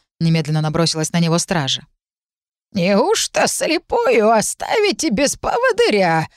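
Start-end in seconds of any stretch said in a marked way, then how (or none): 2.7-6.3 put-on high, slow, creaky old crone; creaky old woman voice. A narrator's reading. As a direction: quaver it out like a blind old nanny. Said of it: "раздался скрипучий старческий голос".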